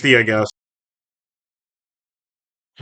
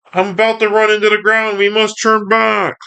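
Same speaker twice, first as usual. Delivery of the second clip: high, hurried cartoon robot voice; Skywarp; 9 semitones higher than usual. Skywarp about to run into the ground, high and urgent. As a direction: rush it out high, in alarm. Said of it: "Scarp goes".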